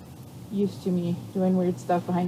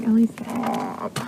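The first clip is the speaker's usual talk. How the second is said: voicing the horse; much slower than usual. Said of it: "he's like".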